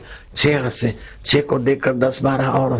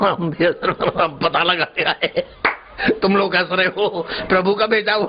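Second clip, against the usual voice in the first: laughing; 8 semitones higher than usual. Acted. Messenger reports, laughing too.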